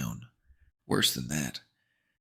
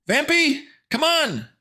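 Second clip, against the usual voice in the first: very high, loud plea; shrieked desperate appeal; pleading; 8 dB louder, slower than usual. A narrator's reading. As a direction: beg at the top of the voice. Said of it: "Fancy Pants pleaded".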